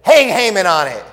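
stern order. Acted. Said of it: "And the king said".

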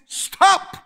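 high loud call of stop; firm command. Retold or imitated. Loving parent ordering the child to halt, loud command. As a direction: snap the order out sharp. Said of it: "the one that's loved him says".

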